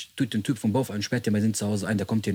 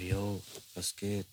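very soft, slow, drawn-out greeting; eerie neighbour greeting; 4 semitones lower, 14 dB quieter, much slower than usual. Retold or imitated. The creepy neighbour greeting him at the door, very soft and slow. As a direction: let it creep out softly and slowly.

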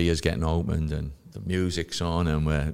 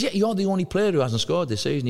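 teasing teammate; 12 semitones higher than usual. Retold or imitated. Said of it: "He says".

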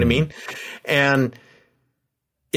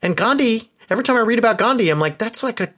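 exasperated disbelief; 10 semitones higher than usual. Retold or imitated. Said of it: "you're like".

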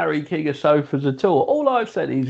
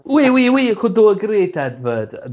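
bad French accent, higher and louder; terrible French accent; 8 semitones higher, 4 dB louder than usual. Bad French accent pitching a sofa advert. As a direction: ham up a fake French accent for an ad. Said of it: "I'm never doing that accent again".

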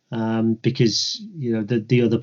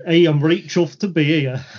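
put-on hammy Yorkshire accent, higher and louder; 5 semitones higher, 5 dB louder than usual. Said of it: "proper Yorkshire".

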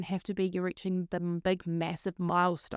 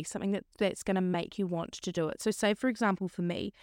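ordinary talk

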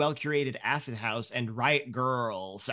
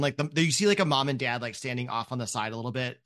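ordinary talk

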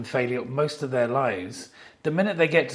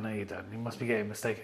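much softer, lower, modest brush-off; quiet denial; 4 semitones lower, 12 dB quieter, faster than usual. Retold or imitated. Telling the young fan he's mistaken, quietly. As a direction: play it down gently. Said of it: "And I went".

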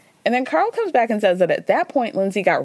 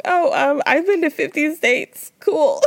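lightly voiced, higher, sheepish boast; mock lame brag; 7 semitones higher, slower than usual. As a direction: play it as a self-mocking awkward flex. Mocking her own lame comeback.

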